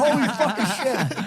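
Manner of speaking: high, slow mock exclamation; hammed-up, laughing astonishment; starstruck amazement. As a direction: imitate a stunned fan, stretching it out. Retold guest astonished at seeing Ryan.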